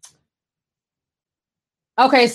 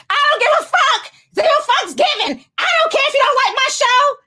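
high, shouted mock rant, words spilling out fast; mocking Kevin Hart rant; 13 semitones higher than usual. Vlogger mocking a celebrity's bloody-nosed rant, high shouted mockery. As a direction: ham up a defiant celebrity yelling that he doesn't care. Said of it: "he goes on this weird rant".

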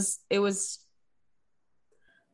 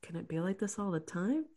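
soft voicing of someone's private thought; imitated inner thought; 9 dB quieter than usual. Wife voicing husband's unspoken thought, softly. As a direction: think it aloud, much quieter. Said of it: "He just thought it internally".

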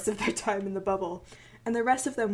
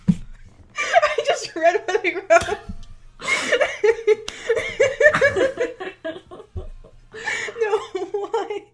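high giggly laugh through words; can't stop laughing while talking; 13 semitones higher, 6 dB louder, much slower than usual. Real, not acted. Giggling through reading own script.

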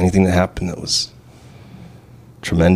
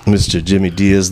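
ordinary talk, nothing marked

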